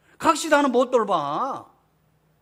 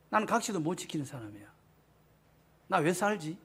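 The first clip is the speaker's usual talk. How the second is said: despairing sigh; 5 semitones lower, 8 dB quieter than usual. Acted. Abraham's despairing imagined thought.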